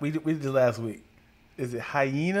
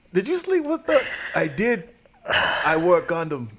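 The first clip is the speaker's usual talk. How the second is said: put-on African accent; 3 semitones higher, 3 dB louder than usual. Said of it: "My African accent".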